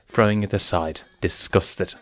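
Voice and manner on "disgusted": ordinary delivery, nothing marked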